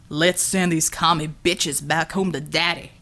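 a brute gloating over a beaten woman. Acted. Villain's voice.

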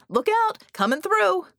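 high, loud yell pushing through. Acted. Red yelling as they burst through the guards. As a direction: clear the way with your voice. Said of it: "Red yelled".

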